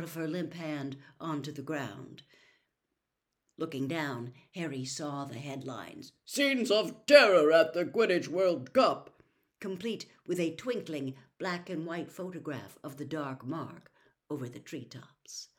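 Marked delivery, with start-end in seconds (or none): 6.2-9.2 headline. A narrator's reading. Newspaper headline read out.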